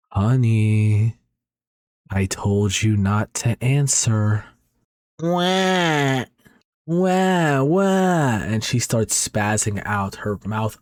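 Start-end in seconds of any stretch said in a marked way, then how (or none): 0.1-4.5 eerie possessed wife. A narrator's reading. Possessed wife warns her husband he answered, slow and eerie. 5.2-8.4 put-on very high, rising louder baby-like wails; possessed wailing. A narrator's reading. Possessed wife wails at her husband, very high and louder.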